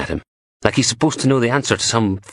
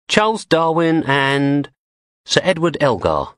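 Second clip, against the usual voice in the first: posh English woman; 8 semitones higher than usual. A narrator's reading. Blondie holds up the notes.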